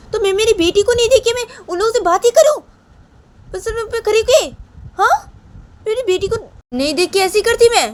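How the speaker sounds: very high, loud angry retort; Payal snapping back. Acted. Payal defends herself to Poonam, loud angry high voice. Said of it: "तो ये पायल वो पूनम को बोलती".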